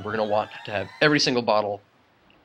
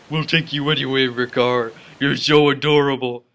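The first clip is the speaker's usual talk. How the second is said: gushing cutesy talk; 5 dB louder than usual. Pretend. Player promises to take adorable little friend along, cooing. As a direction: coo over it like a tiny pet. Gushing over cute Makar.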